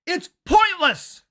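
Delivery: usual speaking voice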